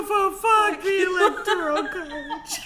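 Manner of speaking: high laughing curse, stuttering start; stammered laughing shout. Real, not acted. Friend repeating the curse, laughing too hard.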